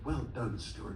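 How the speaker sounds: wife murmuring at his side, dry and soft; dry quiet praise. Retold or imitated. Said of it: "my wife, is standing next to me going".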